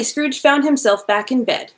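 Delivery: usual speaking voice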